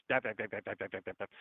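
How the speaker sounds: mock empty chatter, dap dap dap; imitating politicians flapping their lips; lips flapping noise. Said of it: "a bunch of lips flapping".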